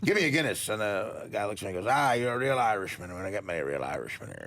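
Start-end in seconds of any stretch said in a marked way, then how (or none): 0.0-0.7 put-on deliberately lame Irish accent; joke-telling mock Irishman; lame Irish brogue. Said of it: "do a lame brogue too here". 1.9-4.4 light mock-Irish lilt, dropping low at the end; a second joke Irishman in a lame brogue; hokey Irish barfly. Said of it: "do a lame brogue too here".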